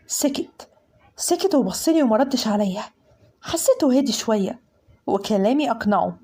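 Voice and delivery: usual speaking voice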